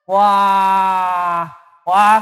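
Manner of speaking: ordinary delivery, nothing marked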